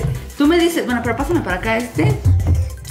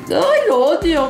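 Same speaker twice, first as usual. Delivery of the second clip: slow frustrated whine; 5 semitones higher, slower than usual. Real, not acted. Frustrated with toy.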